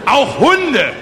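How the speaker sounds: loud, high-pitched boast; emphatic proclamation. Acted. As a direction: announce it as a further triumph. Orator caps his grisly list. (laut und hoch verkündet)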